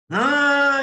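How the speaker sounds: a loud, high, retold no; insistent no. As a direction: throw the no back at her sharply. Mock quarrel between husband and wife.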